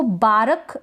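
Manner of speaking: बारक in two syllables with a fully voiced र; over-pronounce the R in बारक, as baa-ruk; बारक with a vowel after the R; wrong full R. Shown as a demonstration. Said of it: "बिल्कुल गलत हो जाएगा".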